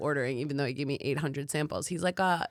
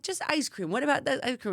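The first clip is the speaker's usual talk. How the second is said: eager for ice cream; 6 semitones higher than usual. Retold or imitated. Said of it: "I'm like".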